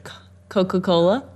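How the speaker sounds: lips not touching. Shown as a demonstration. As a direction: say it without closing the lips. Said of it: "Do it again".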